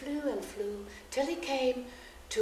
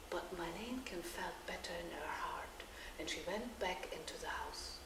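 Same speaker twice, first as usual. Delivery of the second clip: soft whisper; 4 semitones lower, 13 dB quieter than usual. A narrator's reading. Calm after the magic.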